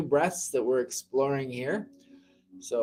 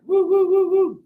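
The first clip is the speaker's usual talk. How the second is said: high, loud cheering woos; excited whoops; 9 dB louder than usual. Real, not acted. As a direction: whoop like you're cheering a friend on. Cheering for a viewer.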